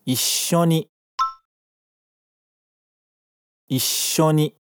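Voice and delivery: "Issho ni" with a short gap between "i" and "sho"; geminate pause. Shown as a demonstration. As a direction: catch the breath after "i" in "Issho". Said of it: "Agora fala depois de mim". ("Issho ni" com vazio entre o i e o sho)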